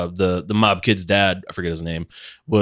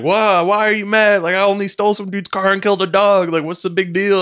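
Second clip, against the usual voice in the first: high-pitched mock whining protest; protesting son; 7 semitones higher than usual. Crime lord's son asking why he's mad, mock protest. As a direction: plead innocence, pitched well above your usual voice. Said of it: "He's like".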